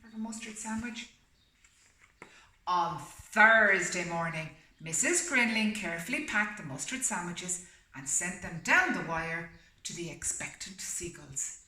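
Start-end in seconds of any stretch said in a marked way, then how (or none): 2.7-11.6 steady storybook reading voice; story narration. A narrator's reading. Reading the story aloud to a listening pet, storybook voice. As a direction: read it like a bedtime picture book.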